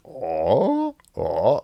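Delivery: drawn-out "ah" sliding down, then up; dipping tone. Shown as a demonstration. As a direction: exaggerate a slow dip and rise on "ah". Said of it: "it goes down and then up".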